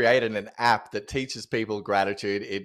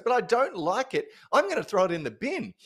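high, stubborn little-girl voice, imitated; defiant four-year-old; 10 semitones higher than usual. Daughter telling grandma she'll bin the present, high and defiant. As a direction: mimic a child flatly refusing a gift. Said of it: "She goes".

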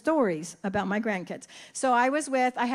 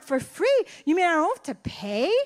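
much higher, louder, delighted mock shock; mock surprise; 5 semitones higher, 3 dB louder than usual. Nana playing along with her grandson in mock astonishment. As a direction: play along as if amazed. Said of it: "I look at him and I'm like".

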